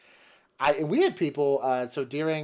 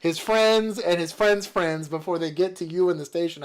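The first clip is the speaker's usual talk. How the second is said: excited; 5 semitones higher, 7 dB louder than usual. Retold or imitated. Exaggerated boast, laughing.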